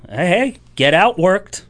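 normal talking voice

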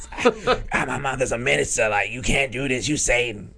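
hammed-up, breathless-starting outcry; imitating screaming woman. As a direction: mimic a comedian mocking a hysterical woman. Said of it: "just imitating her".

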